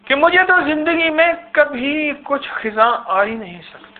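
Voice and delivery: retold pride. Man recalling pride in his worship to the preacher, retold boastfully. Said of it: "इबादत पे तकब्बुर किया".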